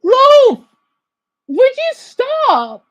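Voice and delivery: high, loud, drawn-out cry; exasperated yell. Real, not acted. Viewer begging the streamer to stop, exasperated yell. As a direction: yell it up high, stretching the words.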